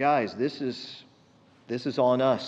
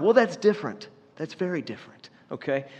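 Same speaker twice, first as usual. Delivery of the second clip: mock defensive; 2 semitones higher than usual. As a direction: play a husband excusing himself. Acting husband's defensive comeback to wife.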